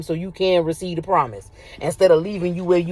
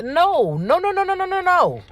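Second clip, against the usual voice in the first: much higher, rapid string of no's; emphatic no; 12 semitones higher than usual. Real, not acted. Preacher refusing online-dating excuse, emphatic high nos. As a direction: shut the idea down hard.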